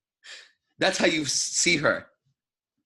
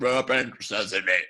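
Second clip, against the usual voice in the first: slow, burped through the words; 6 semitones lower, slower than usual. Retold or imitated. A guy at the cave door, burping it. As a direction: burp the words out slowly. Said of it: "burps it".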